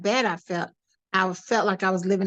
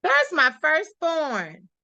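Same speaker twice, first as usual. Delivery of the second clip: lightly voiced mother, higher and drawn out; slow, higher mock motherly call; higher, lingering imitation of a proud mom; imitating mother; 6 semitones higher, slower than usual. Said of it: "I looked up 'cause she said".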